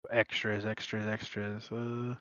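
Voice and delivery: very soft, absent searching mumble; muttering to self. Real, not acted. Player checking for extras for a friend, muttering to self. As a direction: murmur it to yourself while searching.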